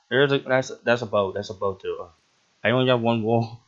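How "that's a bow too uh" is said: ordinary delivery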